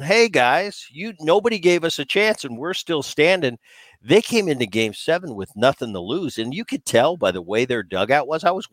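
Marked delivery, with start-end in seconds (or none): 0.0-3.6 mock-cocky, pitched up high; sarcastic. Player taunting the doubters, sarcastic high voice. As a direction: rub it in, sarcastic and smug. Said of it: "kind of sarcastic way".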